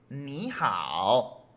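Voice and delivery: NI rising as a second tone, HAO dipping low; smooth Mandarin tone change on "ni hao"; changed third tone. Shown as a demonstration. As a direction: say "ni" as a rise, then "hao" low. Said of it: "se cambia a segundo tono".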